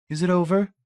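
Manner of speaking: ordinary delivery, nothing marked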